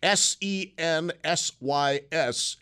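slow letter by letter spelling. Shown as a demonstration. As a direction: spell each letter out slowly. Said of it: "I'll spell that".

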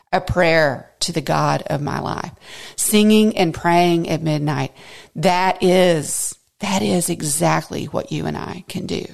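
normal talking voice

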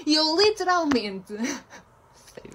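half laughing, half crying in exasperation. Real, not acted. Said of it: "Ela está a chorar". (riso nervoso quase a chorar)

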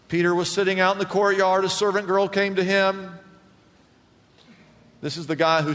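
high, loud, driving sermon voice; full-force pulpit retelling; fiery preaching. Acted. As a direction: preach it hard, climbing with every line.

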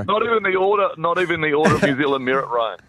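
high, loud, outraged disbelief; mock indignation. Real, not acted. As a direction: protest in playful shock. Mock outrage at losing knighthood.

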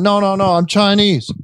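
high-pitched mock protest; comic retold protest; imitated protesting. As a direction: mimic a man objecting, pitched well up. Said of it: "the way the guy was protesting".

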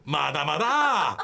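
long, drawn-out teasing "not yet"; mock countdown taunt; gleeful stalling. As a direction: stretch each word out, savouring the delay. Imagined staff stalling caller while clock-watching.

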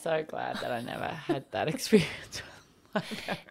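hushed, giggling whisper; whispered aside. Real, not acted. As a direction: breathe it like a guilty secret. Laughing relief, aside.

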